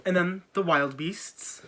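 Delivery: ordinary delivery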